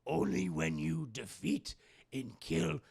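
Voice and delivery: tease, then lay down the condition; Yoda voice. Retold or imitated. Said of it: "He's like".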